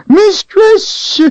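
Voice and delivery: very high loud wail; frightened creature cry. A narrator's reading. Creature wailing for its mistress. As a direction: wail for rescue.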